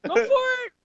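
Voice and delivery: high, mocking go-ahead voice; cheering him off, high and playful; parents egging him on. Parents telling the kid to go ahead, in a high mocking voice. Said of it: "They're like".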